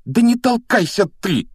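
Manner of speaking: high, loud yelp; shrieked protest; crying out. Acted. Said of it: "вскрикнул, пошатнувшись, Рон".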